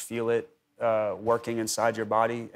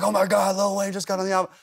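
excited news; 8 semitones higher, faster than usual. Retold or imitated. Waking his wife to tell her about the guest verse, excited. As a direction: burst out with the big news.

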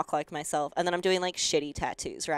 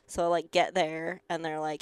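ordinary talk

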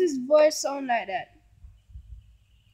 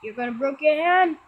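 ordinary delivery

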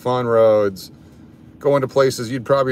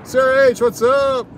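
calling out; 12 semitones higher than usual. Shown as a demonstration. Calling out greeting high.

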